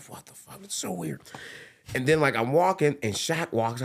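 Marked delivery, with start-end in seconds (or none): 0.0-1.2 whispered dazed thought; whispering. Retold or imitated. Dazed inner thought, retold.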